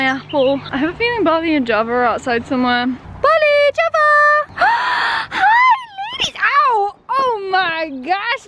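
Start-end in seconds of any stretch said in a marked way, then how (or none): 2.9-4.5 high, loud call across the garden; calling the dogs. Real, not acted. Calling her dogs in from the garden, high and loud. 4.6-8.4 very high, squealing hello; excited gasp and greeting. Real, not acted. Greeting her jumping dogs with a high excited squeal.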